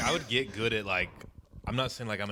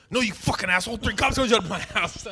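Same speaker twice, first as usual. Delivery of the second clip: mock angry; 10 semitones higher, 6 dB louder than usual. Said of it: "He goes".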